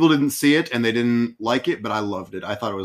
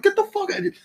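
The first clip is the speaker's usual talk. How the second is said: high-pitched outburst; faster than usual. Retold or imitated. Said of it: "He's like".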